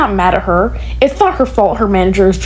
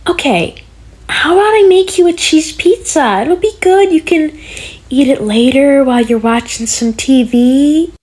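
put-on high, sing-song talk to a kid; talking down to a child; 4 semitones higher than usual. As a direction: coo like speaking to a toddler. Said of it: "Who are you talking to".

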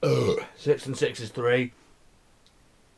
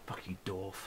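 hushed whispered insult; 5 semitones lower, 14 dB quieter than usual. Real, not acted. Whispering an insult at the map, hushed. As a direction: hiss it under your breath.

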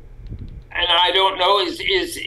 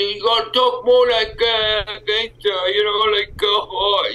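higher-pitched imitation gangster talk; 5 semitones higher than usual. Actor retelling a producer's voice direction, mock gangster voice. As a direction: put on a rough sketch of a gangster. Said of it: "producers thought that my Canadian accent".

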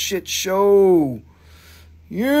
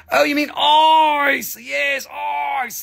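high, slow, shouted Aussie "ice"; Australian shop clerk suddenly understanding, shouted; mock Australian clerk; 9 semitones higher, 4 dB louder, much slower than usual. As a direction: draw the vowel out like the shop clerk. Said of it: "And she said".

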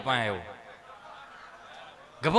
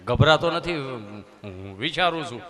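dreamy reply; 5 semitones lower, 4 dB louder, slower than usual. Acted. Nervous man answers nurse.

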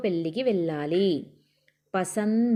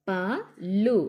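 "పళ్ళు" split into two separate letters, pa, lu; much slower than usual. Shown as a demonstration. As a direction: spell out "పళ్ళు" with a gap between pa and lu. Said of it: "ఒత్తి పట్టి చదవాలి".

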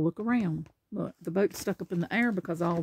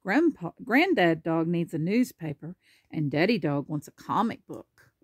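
ordinary delivery

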